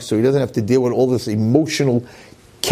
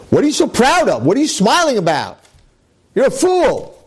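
higher, sneering taunt from Satan; mocking devil; 10 semitones higher than usual. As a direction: needle him like a scornful devil. Satan mocks Yankel.

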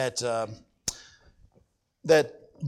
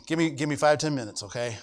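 ordinary talk

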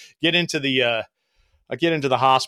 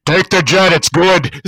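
loud, commanding radio pronouncement; voice of God; 3 semitones higher, 9 dB louder, slower than usual. Retold or imitated. Master chief on the radio settling it, loud and booming. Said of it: "the voice of God comes over the radio".

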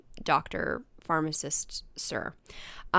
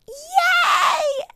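high, loud cheering squeal; excited yay; 8 dB louder than usual. Retold or imitated. Jackie cheering at the pharmacist's news with a high, loud squeal. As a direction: cheer like you just won. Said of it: "And Jackie goes".

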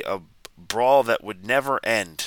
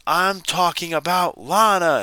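higher, louder, slow dramatic reveal; mock scream; 8 semitones higher, 5 dB louder than usual. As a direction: belt out the big accusation. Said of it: "And she screams".

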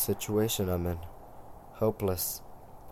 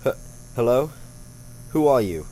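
loud, frightened calling out; bang roepend; 5 semitones higher, 6 dB louder than usual. Acted. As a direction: stammer out, startled and loud. Wakes in strange room, calls out.

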